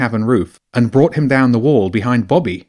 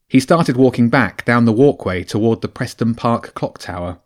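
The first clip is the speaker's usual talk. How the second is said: usual speaking voice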